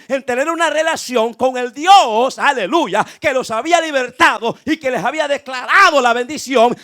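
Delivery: loud, impassioned sermon delivery; full-force preaching. Real, not acted. Preacher telling the church of hardened ancient hearts, impassioned preaching.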